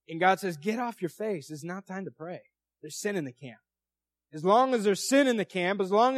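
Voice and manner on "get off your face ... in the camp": God cutting off the prayer, firm and hushed; quiet stern divine command. Acted. God rebukes Joshua.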